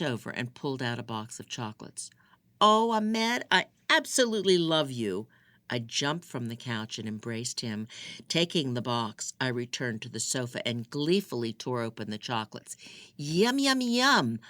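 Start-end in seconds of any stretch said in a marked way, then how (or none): 2.6-5.3 delighted gush. A narrator's reading. She receives chocolates. 13.2-14.4 gleeful yum. A narrator's reading. Tearing open chocolates.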